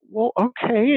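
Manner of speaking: high-pitched, stubborn wife; digging heels in. Retold or imitated. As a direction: imitate a wife who won't let it drop. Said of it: "you can hear in her voice, she's digging her heels in".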